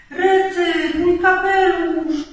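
high, loud, drawn-out exclamation; alarmed cry. A narrator's reading. Rabbit loses his hat to the wind, crying out. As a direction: cry out in dismay. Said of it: "krzyknął królik".

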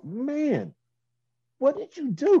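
high-pitched imitation of an impressed man; amazed. Impressed man asking the vocal coach his secret, amazed.

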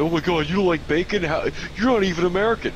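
mocking outraged voice. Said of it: "fucking flips out".

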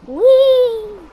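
long, high, loud whee; excited whee. Real, not acted. Playing a video game, excited whee. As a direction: squeal a drawn-out whee.

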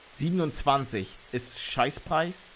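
normal talking voice

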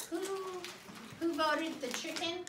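plain reading voice

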